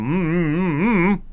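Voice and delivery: muffled mock mumbling; closed-mouth humming. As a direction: hum as if the lips are sealed. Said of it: "he just sat there and go".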